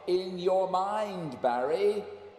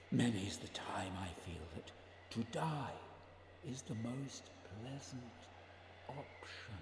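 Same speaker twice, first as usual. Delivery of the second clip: soft, unvoiced whisper; whispered confession; 6 semitones lower, 12 dB quieter than usual. [name] confessing to Barry he thinks of death, whispered. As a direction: breathe it out as a secret.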